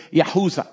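Yahuza with a Y, no J sound; Hebrew pronunciation. Shown as a demonstration. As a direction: say Yahuza, ya-HOO-za. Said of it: "if I were to read the name Judah".

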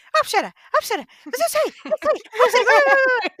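high, loud nagging pupil calling teacher; mock-whiny kid pestering the teacher. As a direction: whine for teacher's attention over and over. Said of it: "um puto que diz assim".

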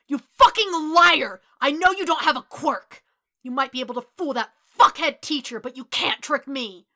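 loud, high furious shout; full-force angry yelling; enraged accusation. A narrator's reading. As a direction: roar it at the liar. Enraged boy accusing the other.

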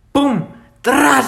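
high, punchy mock gunshot sounds; gunshot noises. Telling a friend how his shotgun fired, mock gunshot sounds. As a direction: spit out the shots like a kid's toy gun. Imitating the gun's shots.

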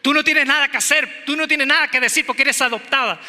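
high, loud teasing jab; mock sibling taunt. Older sibling taunting the youngest, a loud mocking jab. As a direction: lord it over the little one.

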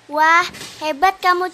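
normal talking voice

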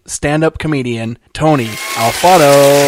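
announcer intro read over music; podcast intro. Acted. Host opening the podcast, announcer voice. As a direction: welcome listeners like a show host.

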